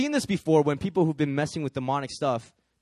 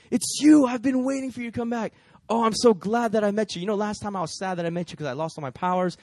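gushing excitement; 3 semitones higher, 6 dB louder than usual. Retold or imitated. Ex-witch gushing about his new life.

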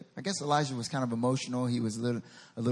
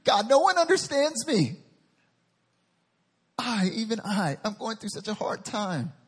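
high, loud self-pitying wail; mock sob story; 5 semitones higher, 4 dB louder than usual. Preacher mimics Elijah feeling sorry for himself, whiny. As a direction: whine it dramatically, high and aggrieved. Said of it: "Can you hear a sob story".

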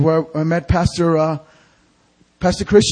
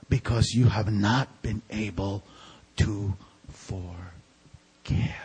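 softer, slow, breathy whisper; 9 semitones lower, 10 dB quieter, slower than usual. Real, not acted. Preacher confiding why people stay away, whispered slowly. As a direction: let it fall to a hush.

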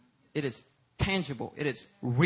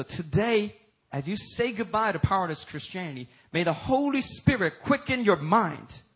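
full-force preaching; 8 semitones higher, 9 dB louder than usual. Real, not acted. Preacher prays over the congregation for unveiled eyes, impassioned preaching.

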